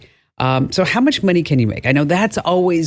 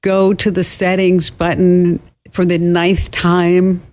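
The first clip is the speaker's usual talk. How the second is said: slow, deliberate mock-patient instruction; higher, drawn-out imitation of worn patience; strained patience; 4 semitones higher, slower than usual. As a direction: spell it out slowly, exasperation held in. Acting strained patience with mom.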